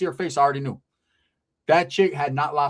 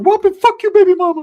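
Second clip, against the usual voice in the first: very high, loud comic yell; cousin's put-on voice; 11 dB louder than usual. Cousin cursing the angry homeboy, high loud squawk. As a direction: squawk it defiantly. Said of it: "Ricky's like".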